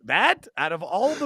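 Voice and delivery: loud, high disbelief; acted outraged astonishment; incredulous. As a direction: question it as if it were absurd. Retold disbelief at favorite movie choice.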